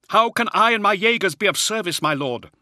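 a harried Jaeger officer reporting under pressure; Hadziel, harried officer. Acted. Officer's voice held by narrator.

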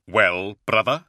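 "Well, brother" rising as a question; prompting. Acted. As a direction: lift the end of "brother" expectantly. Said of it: "Luther prompted".

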